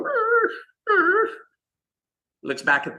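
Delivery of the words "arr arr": high, yelping dog whine imitated; dog whining. Telling the porch-dog story, imitating the dog's high whining cry. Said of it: "the dog's just going".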